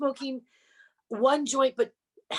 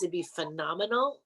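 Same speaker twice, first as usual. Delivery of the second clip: ordinary talk, nothing marked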